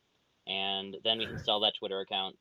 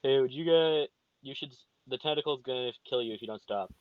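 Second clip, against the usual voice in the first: half-hearted threat, raised then easing off; mock intimidating shout; 4 semitones higher than usual. Player threatening kobolds in character, mildly intimidating shout. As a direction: start with a raised mock-menacing call, then let it drop. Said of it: "I'm gonna shout something intimidating like".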